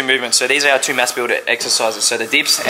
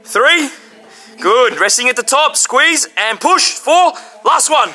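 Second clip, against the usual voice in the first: counting workout reps; 7 semitones higher than usual. Real, not acted. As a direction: push him through every rep. Spotting partner through a set.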